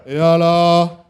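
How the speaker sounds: loud drawn call; loud countdown. Real, not acted. Host calling the score reveal, loud. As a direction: bring the room in.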